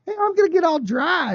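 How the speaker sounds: high, chirpy mock quote; mock happy actress. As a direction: play a relieved actress, lightly. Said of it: "So she went from".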